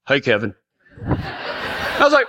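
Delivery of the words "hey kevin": deep, low drawn hello; Chili's greeting. Retold or imitated. Injured carpenter greeting the boy, deep and low. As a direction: say it like a gruff grown man. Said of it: "And Chili Holt goes".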